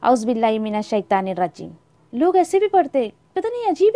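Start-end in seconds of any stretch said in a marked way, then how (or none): 0.0-1.7 wrong rushed recitation. Shown as a demonstration. Teacher mocking a wrong way of reciting, quick mumble. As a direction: rattle it off carelessly. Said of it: "ऐसे भी नहीं आपको पढ़ना".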